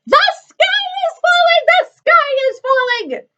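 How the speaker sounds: very high clucking hen in panic; Chicken Licken. Acted. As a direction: cut in with a squawked alarm. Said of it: "clucked Chicken Licken".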